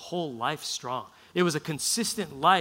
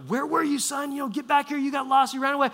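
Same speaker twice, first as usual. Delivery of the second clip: high-pitched mock mom scolding; worried scolding mother; 9 semitones higher than usual. Mary scolds her runaway son, high mock fretting. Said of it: "And Mary's going".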